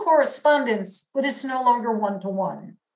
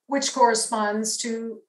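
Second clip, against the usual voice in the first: normal talking voice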